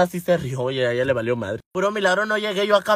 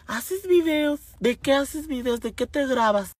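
lightly voiced nosy lady, higher and softer; curious questions in a higher, soft voice; 5 semitones higher, 5 dB quieter, slower than usual. Lady on the bus asking about her videos, nosy.

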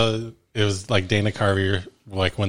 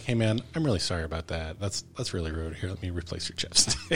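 much softer, sheepish apology; mock sincere apology; 4 semitones lower, 9 dB quieter than usual. First guy apologizing for chapstick, softly sheepish. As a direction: quietly make amends, half-hearted. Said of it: "He's like".

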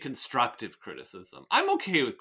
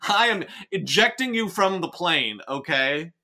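loud, higher, exasperated mock ultimatum; mock threat; 5 semitones higher, 9 dB louder than usual. Reader threatening to eject co-host, in mock outrage. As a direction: threaten them in hammed-up outrage.

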